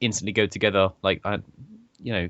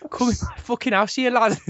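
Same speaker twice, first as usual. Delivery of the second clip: very high, strained-pitch Scouse attempt; bad Scouse accent; 14 semitones higher than usual. Friend quoting the Scouse Bear to his mate, bad Scouse accent. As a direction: have another go at hammed-up Scouse. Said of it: "I can't do a Scouse accent".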